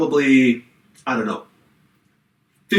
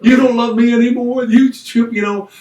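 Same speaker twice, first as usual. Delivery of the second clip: higher, louder mock-sobbing plea; hammed-up tearful accusation; imitating bawling wife; 8 semitones higher, 4 dB louder than usual. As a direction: whine it like a crying wife. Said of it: "Kim's bawling".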